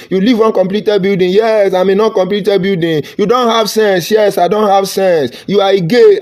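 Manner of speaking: high, singsong mock back-and-forth; mock accusations and agreement. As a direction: parrot each insult and own it defiantly. Acts out insults and his agreeing.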